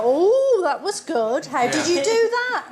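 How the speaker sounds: hammed-up wonder for the waiting magician; fake enthusiasm. Diner forced to praise the table magician's trick, hammed-up gushing. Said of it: "you've gotta go".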